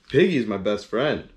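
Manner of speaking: ordinary delivery, nothing marked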